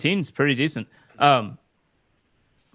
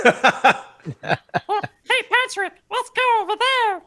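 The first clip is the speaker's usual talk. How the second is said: much higher and louder cartoon voice with a laugh; SpongeBob attempt; 6 dB louder, much slower than usual. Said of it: "No, I don't know".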